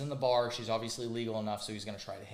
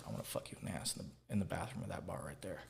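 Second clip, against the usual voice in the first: whisper; 8 semitones lower, 11 dB quieter than usual. Retold or imitated. Creepy pickup line retold in whisper.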